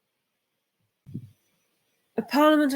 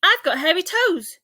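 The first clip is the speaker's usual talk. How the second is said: loud, high cheerful shout; 8 semitones higher, 10 dB louder than usual. A narrator's reading. Ike shouting to the giraffe from a branch. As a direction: belt it out like a proud little monkey. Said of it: "shouted Ike".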